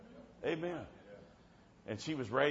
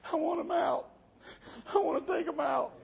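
high, louder tearful plea; imitated crying; 4 dB louder than usual. Girl wanting her piercings out, imitated crying plea. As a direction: mimic a crying girl begging for relief. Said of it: "put her head down and started crying".

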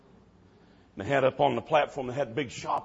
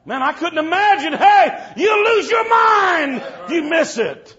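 high, loud, preaching at full force; preaching shout; 14 dB louder, slower than usual. Real, not acted. Preacher warning the congregation, shouting hard.

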